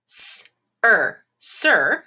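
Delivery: S-er stretched then blended into sir; sounding out syllable. Shown as a demonstration. Teacher sounding out a syllable for the class. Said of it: "let's go ahead and say this".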